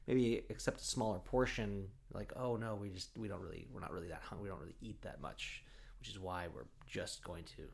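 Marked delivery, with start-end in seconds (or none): 2.4-5.6 softly murmured polite excuse; half-whispered fib to the hosts; quiet, apologetic dinner excuse; hushed excuse. Retold or imitated.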